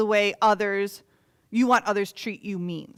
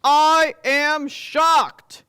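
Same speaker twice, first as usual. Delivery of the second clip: drawn out, loud, slow shock; king voicing his shock, slow and loud; 5 semitones higher, 11 dB louder, much slower than usual. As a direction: stretch every word in dismay. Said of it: "The king was shocked".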